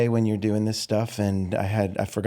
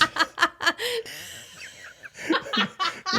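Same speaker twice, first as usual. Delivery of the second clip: high burst of laughter; laughing. Real, not acted. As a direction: crack up laughing. Host cracks up too.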